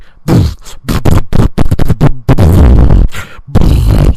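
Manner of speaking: loud spluttering raspberry noise mimicking an explosive fart; imitated fart blast. Telling friends about a stranger's loud toilet blowout, loud imitated fart noise. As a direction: blow a long wet raspberry like gunfire. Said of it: "and then it was like".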